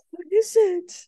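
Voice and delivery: high, amazed gasp then a wondering question; excited dog voice. Retold or imitated. Teacher voicing her dog spotting a deer, high and amazed. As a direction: voice the dog seeing deer for the first time, wide-eyed. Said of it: "he was just like".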